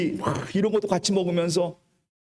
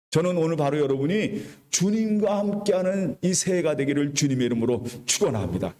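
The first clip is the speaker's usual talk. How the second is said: ordinary talk, nothing marked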